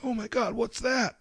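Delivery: put-on high, soft little-kid voice; curious little daughter. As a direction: put on a small, high child voice. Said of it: "she's like".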